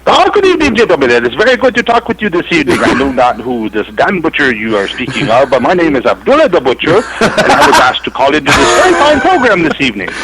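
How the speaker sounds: a lightly put-on Irish-tinged villain voice, grand and formal; Irish jihadist voice. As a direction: play a mock Abdullah the Butcher with a faint Irish lilt. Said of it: "That sounded almost like an Irish".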